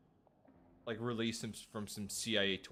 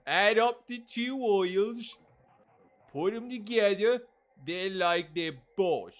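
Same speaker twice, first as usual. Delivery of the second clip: high, loud British character, falling off at the end; put-on British accent; 7 semitones higher, 5 dB louder than usual. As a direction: drag out a hammed-up British accent. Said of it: "I'm trying to do a British accent".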